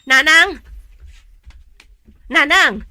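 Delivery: loud anguished calls to mother; calling out loud. Acted. Child calling loudly to mother found dead, anguished call. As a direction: cry out for her, loud and desperate.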